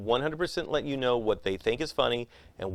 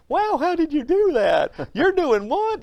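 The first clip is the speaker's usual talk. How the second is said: high, loud gushing nerd voice; awed magic nerd; 6 dB louder than usual. Retold or imitated. Magic nerds marveling at a trick, high squeal. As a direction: squeal it like a starstruck fan.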